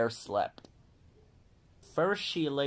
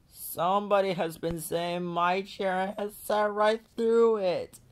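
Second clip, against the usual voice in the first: put-on higher, small wailing voice of the baby bear; little wee bear crying; 8 semitones higher than usual. A narrator's reading. Baby bear finds his porridge eaten, wailing. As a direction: whimper it out like a tiny bear. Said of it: "cried the little wee bear in his little wee voice".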